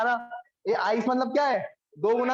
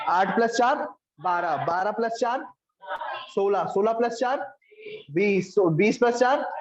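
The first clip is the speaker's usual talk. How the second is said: slow step-by-step counting; slower than usual. Shown as a demonstration. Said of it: "counting up slowly for students".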